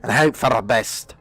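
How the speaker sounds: a stern Scotsman's warning about the fiends; attempted Scottish accent, half-hearted. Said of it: "Now do a Scotsman".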